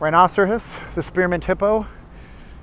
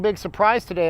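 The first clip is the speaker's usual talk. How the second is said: ordinary talk, nothing marked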